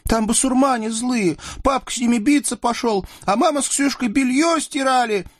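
put-on very high-pitched small child's voice; high, piping little girl on the verge of crying; tearful little girl. A narrator's reading. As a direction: report it like a frightened child close to tears. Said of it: "чуть не заплакала".

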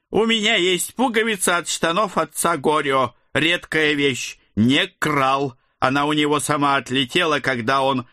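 high, fussy odd uncle; eccentric uncle voice. A narrator's reading. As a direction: play him as a rambling quirky god. God character Ed voiced.

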